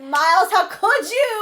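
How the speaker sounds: high, drawn-out wounded reproach; melodramatic, high-pitched accusation; mock outrage. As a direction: wail it slowly like someone betrayed. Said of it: "I was so".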